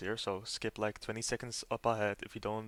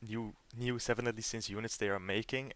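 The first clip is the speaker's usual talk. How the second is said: ordinary delivery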